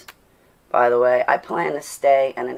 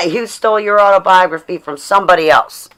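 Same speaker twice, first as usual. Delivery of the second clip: loud laughing jab; hammed-up hater taunt; mock hater; 5 semitones higher, 8 dB louder than usual. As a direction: shout the accusation mockingly.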